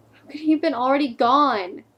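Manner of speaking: loud burst of acted grief; anguished disbelief. Girlfriend hearing Steve was already gone, anguished disbelief. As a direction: cry out the question in disbelief. Grieving girlfriend cannot believe the death.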